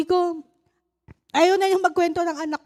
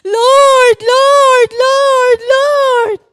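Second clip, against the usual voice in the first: high, loud, tearful cries of Lord; high, loud sobbing plea to God; crying out to God; 11 semitones higher, 16 dB louder, much slower than usual. Retold or imitated. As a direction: cry out in desperate prayer, voice breaking. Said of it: "sabi ko lang".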